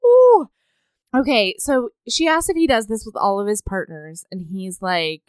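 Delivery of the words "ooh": squealed ooh; high excited ooh. Real, not acted. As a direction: squeal it, thrilled. Reacting to the steamy scene.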